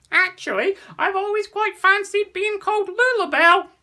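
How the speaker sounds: very high and loud squawky bird; a puffed-up bird announcing her fancy name; odd bird voice. Acted. Strange green bird speaks up.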